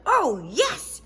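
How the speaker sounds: high thrilled oh yes; gleeful little girl; excited. Acted. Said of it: "said Sheila".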